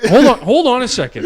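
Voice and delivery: high, loud mock-indignant dad; worked-up dad. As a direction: call out like an incensed dad. Dad worked up over survey call.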